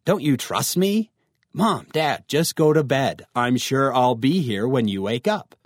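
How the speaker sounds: usual speaking voice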